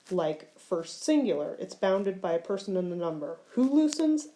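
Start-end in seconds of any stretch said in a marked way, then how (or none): 3.5-4.3 questioning. Shown as a demonstration. Teacher asks drill questions.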